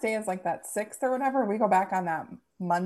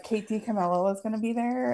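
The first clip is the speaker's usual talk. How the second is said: ordinary talk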